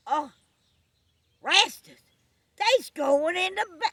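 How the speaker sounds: high, loud play of panic; alarmed yell. Acted. Ralph reporting to Rastus through the scope, alarmed yell. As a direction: yell the bad news and break off.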